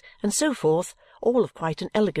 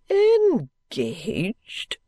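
appalled cry; 3 semitones higher than usual. A narrator's reading. Father learning of the engagement, melodramatically appalled. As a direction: cry it in theatrical disbelief. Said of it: "cried Mister Turveydrop".

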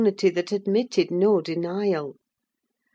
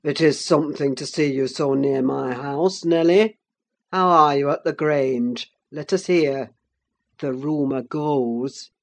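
lower, firm male character voice; low-voiced Heathcliff, probing; gruff master Heathcliff; 4 semitones lower, 4 dB louder than usual. A narrator's reading. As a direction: speak low and forceful as the master of the house.

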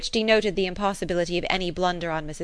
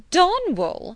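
high, loud astonished cry; cried out; 12 semitones higher, 6 dB louder than usual. A narrator's reading. Mrs Elton correcting her husband, crying out. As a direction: call out the name in disbelief. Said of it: "cried his wife".

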